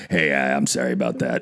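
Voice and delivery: low, quiet mumbled apology; apologetic coach. Acted. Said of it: "do you think a coach is ever like".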